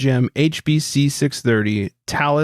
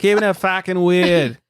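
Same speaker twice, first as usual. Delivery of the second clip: higher, hammed-up Boston accent; 5 semitones higher than usual. Host jokily repeating the Utah line, Boston accent. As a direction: drop the R in a mock Boston accent. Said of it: "going back to Boston".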